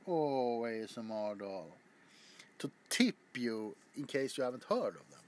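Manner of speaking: ordinary delivery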